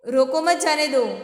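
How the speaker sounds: "रोको मत जाने दो" run together without any break; all four words of "रोको मत जाने दो" joined up; no pause. Shown as a demonstration. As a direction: read "रोको मत जाने दो" in one unclear stream. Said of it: "कुछ क्लियर नहीं है".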